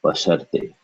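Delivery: "Basharte" as one word, r straight into te; correct joined pronunciation. Shown as a demonstration. Teacher repeating the correction, "Basharte" joined as one word. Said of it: "but this is basharte".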